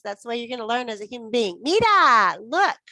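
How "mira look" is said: loud, high, emphatic repetition drill; teacher drilling words loudly for the class. Shown as a demonstration. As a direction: call out each word like a drill leader. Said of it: "You have to say it lots of times".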